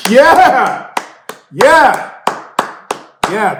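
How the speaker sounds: very high, very loud whoop; cheering yell. Real, not acted. Cheering for Mexico after the story, loud whoop. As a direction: whoop it out like a fan. Celebratory shouts.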